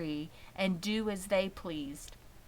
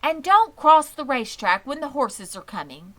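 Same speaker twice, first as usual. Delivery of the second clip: higher and louder, alarmed call; crying out; 7 semitones higher, 12 dB louder than usual. A narrator's reading. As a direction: cry out a warning about the horses. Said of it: "cried Miss Zuckerman".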